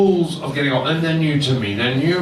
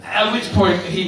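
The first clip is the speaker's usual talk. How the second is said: ordinary talk, nothing marked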